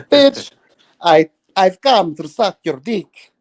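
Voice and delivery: loom over the victim with a vampire's spell. Pretend. Acting as seductive vampire.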